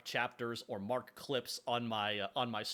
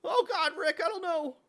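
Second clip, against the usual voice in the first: put-on much higher, louder nervous boy voice; Morty voice; 8 dB louder than usual. Morty answers Rick, much higher and panicky. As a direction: squeak it out like a flustered kid.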